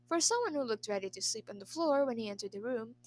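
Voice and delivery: ordinary delivery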